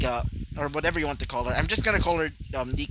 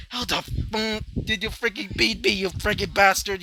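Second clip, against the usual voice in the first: raging curse; 7 semitones higher than usual. Acted. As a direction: spit out the insult in fury. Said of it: "saying in rage".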